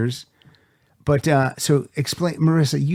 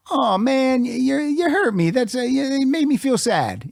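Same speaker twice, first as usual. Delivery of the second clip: high, hammed-up wounded reply; mock hurt; 10 semitones higher than usual. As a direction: pretend your feelings are hurt, sarcastically.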